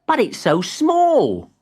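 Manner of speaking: high, slow puzzled voice; puzzled young tree. A narrator's reading. Timbertwig looks at the tiny scarf, high and puzzled. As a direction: sound let down but polite.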